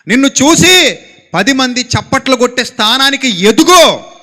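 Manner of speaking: loud, rising exhortation; preaching at full force. Acted. Preacher loudly urges the young to rise and shine. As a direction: rouse the youth to shine.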